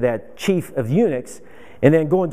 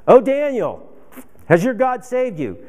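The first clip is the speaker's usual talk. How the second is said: mock yell; 6 semitones higher than usual. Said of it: "King Darius yells in and says".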